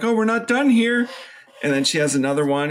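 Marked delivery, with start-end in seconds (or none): 0.0-1.1 higher, louder mock surprise; mock doctors. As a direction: announce it a bit high. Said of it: "they're like".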